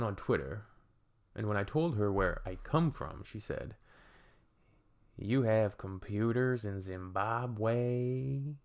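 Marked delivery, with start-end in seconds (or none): 5.2-8.6 drawn-out, rising question in a put-on voice; imitating a clueless, astonished questioner; mock incredulous. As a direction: stretch every word in exaggerated disbelief. Mocking imitation of surprised question.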